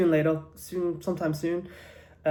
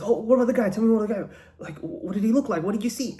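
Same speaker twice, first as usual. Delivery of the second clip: higher-pitched, pressing for details; urgent questioning; 6 semitones higher, much faster than usual. Acted. Police asking witness about the robber, urgently questioning. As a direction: act a cop eagerly grilling a witness.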